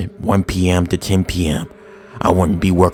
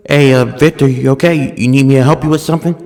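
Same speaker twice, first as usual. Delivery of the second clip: raised, fast friendly question; friendly call; 6 semitones higher, 6 dB louder, faster than usual. Retold or imitated. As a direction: call out to him brightly. Calling out to coworker.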